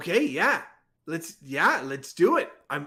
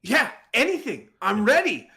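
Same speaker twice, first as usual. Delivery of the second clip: 1 eager; 9 semitones higher, 6 dB louder than usual. Acted. Eager son volunteers.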